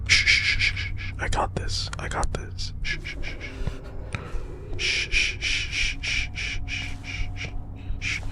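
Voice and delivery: breathy whispered shushing, barely audible; hushing whisper. Real, not acted. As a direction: hush yourself so the monster won't hear. Sneaking past monster, hushing self.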